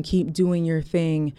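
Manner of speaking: ordinary delivery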